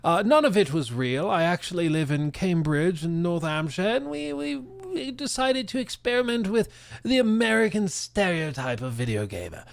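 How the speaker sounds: put-on a fairly light posh British accent. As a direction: play the smug English mastermind in a posh British accent. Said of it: "thick, posh British accent".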